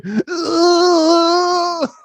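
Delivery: high, drawn-out comic noise; weird dice noise. Retold or imitated. Co-host mimics Dice's trademark noise, high and long. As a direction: imitate the comic's odd squeal. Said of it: "He makes that weird dice noise".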